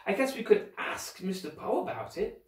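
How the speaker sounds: mildly unsure; doubtful. A narrator's reading. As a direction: float the idea without conviction. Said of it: "Violet said doubtfully".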